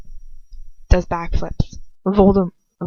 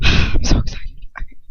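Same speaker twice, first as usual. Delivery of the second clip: ordinary delivery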